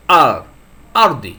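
আর then আরদি punched loud; emphatic demo. Shown as a demonstration. Teacher drilling students on the word, loud emphatic delivery. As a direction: hit আর and আরদি hard for the class. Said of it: "উচ্চারণ হলো না".

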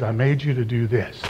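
quiet divine assurance; intimate voice of God. Retold or imitated. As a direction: speak it gently as God to his child. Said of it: "the voice of God".

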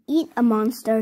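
ordinary delivery, nothing marked

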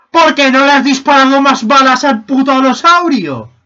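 loud, high-pitched mock scolding; hammed-up outraged demand; exasperated shouting. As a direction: yell at a useless teammate. Scolding a teammate in the game.

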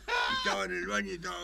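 ordinary delivery

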